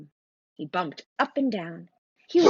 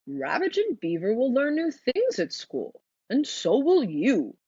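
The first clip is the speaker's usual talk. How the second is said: kindly teacher owl; 5 semitones higher, 4 dB louder than usual. Acted. Mister Owl reassures nervous Franklin in a lightly voiced gentle teacher tone. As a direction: reassure the shy pupil as a mild old owl.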